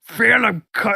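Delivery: cartoon dog voice. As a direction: try a goofy cartoon dog. Copies the character voice.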